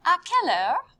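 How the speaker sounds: "À quelle heure" in one run, rising at the end; high, loud, connected "À quelle heure"; joined-up phrase. Shown as a demonstration. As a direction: model the whole question without pauses. Teacher joins the phrase up.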